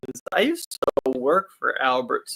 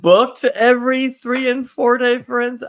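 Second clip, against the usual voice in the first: high, loud disbelief; shocked question, pitch jumping up; surprised; 10 semitones higher, 6 dB louder than usual. Real, not acted. Incredulous at husband's booking spree.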